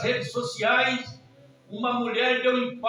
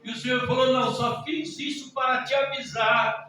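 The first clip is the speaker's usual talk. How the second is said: raised, insistent preacher's voice; full-force preaching; 4 semitones higher, 7 dB louder than usual. Real, not acted. Preacher warning the church with God's message, forceful.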